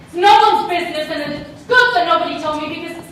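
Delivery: furious confrontation. Acted. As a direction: fight for the truth he was denied. Confronting mother about the truth.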